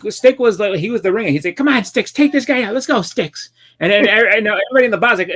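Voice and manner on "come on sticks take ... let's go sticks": high-pitched mock cheering on; acted egging on. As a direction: urge your fighter on, voice pitched up. Movie crowd egging on ringer.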